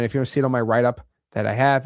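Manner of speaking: normal talking voice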